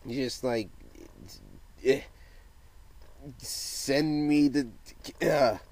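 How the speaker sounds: halting, broken-up stammer; stuttering. Real, not acted. Vlogger struggling to ask for questions, halting stutter. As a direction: stall between words with fillers and long gaps. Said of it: "there is a reason why I'm stuttering".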